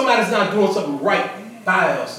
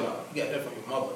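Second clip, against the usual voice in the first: low, quick, much softer retort; joking aside; 10 semitones lower, 15 dB quieter, much faster than usual. Retold or imitated. Father jokingly blames the mother for daughter's anger, quiet quick aside. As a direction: slip it in fast and under the voice.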